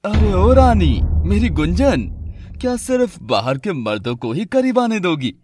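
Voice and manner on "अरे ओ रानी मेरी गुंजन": put-on loud, high, leering drunk shout; drunken leering call. Drunk husband taunting his wife, leering loud call. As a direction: call out to her like a drunk lout.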